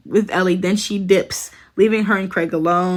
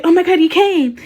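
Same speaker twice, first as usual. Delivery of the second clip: higher and louder, relieved gasp of surprise; as if suddenly seeing her arrive; 10 semitones higher, 5 dB louder than usual. Retold or imitated. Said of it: "He's like".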